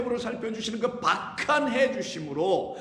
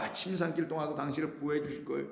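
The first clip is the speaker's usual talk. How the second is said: mock sweet reassurance; 6 semitones lower, 8 dB quieter, faster than usual. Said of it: "이런 게 아니라".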